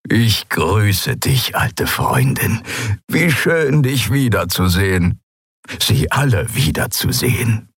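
slow, lightly voiced old man; old Skria. Old Suran gladly greets his old friend. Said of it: "Der alte Mann lächelte".

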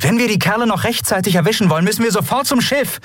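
very high, rapid, pressing voice; urgent Endriel. Acted. Endriel rushes her crew to the ship, urgent. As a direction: press them onward, fast and high-pitched.

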